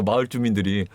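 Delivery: ordinary talk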